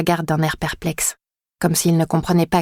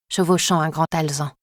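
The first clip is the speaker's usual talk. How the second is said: ordinary delivery, nothing marked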